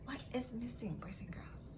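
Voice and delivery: secret whispered question; hushed game-show tease. Acted. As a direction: lean in and whisper it. Teasing quiz question to children.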